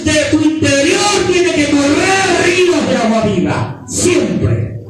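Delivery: shouted preaching at full force; predicación a gritos. Acted. (predicador gritando con fervor)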